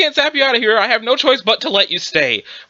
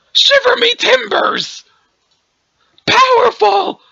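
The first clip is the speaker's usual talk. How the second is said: hammy exclamation; 11 semitones higher, slower than usual. Acted. Exclamation amid a power struggle.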